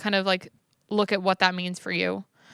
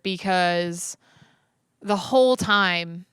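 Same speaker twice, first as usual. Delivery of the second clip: ordinary delivery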